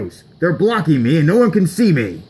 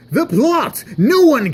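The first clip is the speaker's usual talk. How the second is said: the plot yelled much higher; louder higher shout; 9 semitones higher, 4 dB louder than usual. Acted. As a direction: yell it again, more exasperated. Frustrated character calls out.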